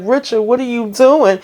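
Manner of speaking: scolding wife. Retold or imitated. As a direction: call him out, shocked. Nancy scolding husband, voiced.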